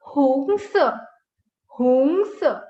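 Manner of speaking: HONGS with an oo sound, like "hoong-suh"; correct HONGS vowel. Shown as a demonstration. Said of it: "It's not hongs".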